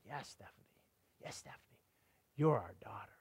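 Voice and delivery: hushed, breathless whisper through tears; tearful whisper. Retold or imitated. Mother whispering to her adopted daughter through tears.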